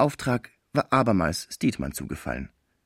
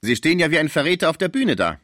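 mocking; 8 semitones higher, 5 dB louder than usual. A narrator's reading. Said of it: "fragte er spöttisch".

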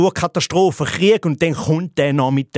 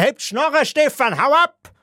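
high-pitched mock outburst; indignant crowd telling someone off; acted heckling; 11 semitones higher than usual. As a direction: heckle him in a high, exasperated voice. Crowd yelling at a braggart.

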